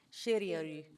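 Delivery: shirioru as shi-ri-o-ru; syllable-timed shirioru with a tapped r; Japanese cereal. Shown as a demonstration. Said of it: "how to say cereal in Japanese".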